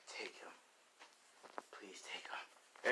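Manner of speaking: slow, hushed whisper; acted whispering, barely voiced; whispered plea. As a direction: beg in a whisper, drawing it out. Begging helper to take friend.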